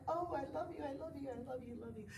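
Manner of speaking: high, soft loving coo; self-loving gush. Acted. Yoga teacher acting out hugging yourself, tender coo. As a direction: coo it sweetly and quickly to yourself. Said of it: "like, hug yourself".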